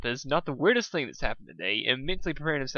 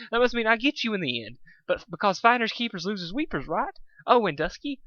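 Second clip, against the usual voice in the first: bubbly high voice; 7 semitones higher than usual. Acted. Pinkie high rambling.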